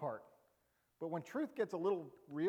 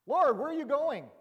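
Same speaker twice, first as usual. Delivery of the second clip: eager Peter; 9 semitones higher, 10 dB louder than usual. Retold or imitated. Peter voiced in Bible retelling.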